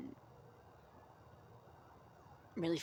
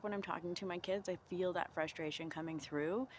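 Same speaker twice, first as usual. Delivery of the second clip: usual speaking voice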